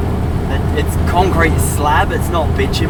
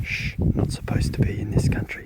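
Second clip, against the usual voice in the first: whispering; 10 dB quieter than usual. Real, not acted. Sneaking a drone flight.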